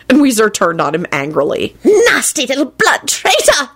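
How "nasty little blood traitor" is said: slow, high venomous elf voice; angry hiss. A narrator's reading. House-elf turning on the boy, high angry hiss. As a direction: spit the insult out, drawn and pitched up. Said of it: "he hissed".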